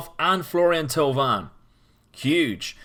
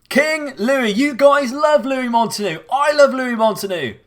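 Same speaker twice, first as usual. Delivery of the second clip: excited; 11 semitones higher, 6 dB louder than usual. Real, not acted. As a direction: still high and loud with joy. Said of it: "we're way too excited".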